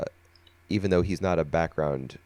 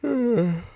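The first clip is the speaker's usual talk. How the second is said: long high yawn; big yawn. Real, not acted. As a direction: let out a real yawn. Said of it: "Sorry, I keep yawning".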